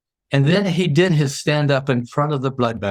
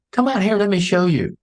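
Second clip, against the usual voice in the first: higher-pitched, eager invitation; eager beckoning; 8 semitones higher than usual. Acted. Founder inviting a reporter outside, eager. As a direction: call them over, pitched up. Acted invitation against stay-at-office quote.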